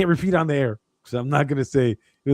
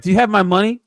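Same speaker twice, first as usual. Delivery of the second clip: louder, higher turned-around demand; mock demand; 6 semitones higher, 6 dB louder than usual. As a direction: flip the scam back on them. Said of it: "I did that one time".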